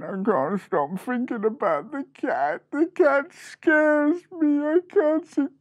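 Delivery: high wail, rising and dragging out; squeaky little mouse working up to tears; tearful fear. Acted. Freddy left alone thinking about the cat, tearful. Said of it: "And he said".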